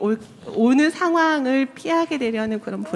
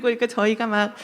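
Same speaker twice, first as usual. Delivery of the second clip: usual speaking voice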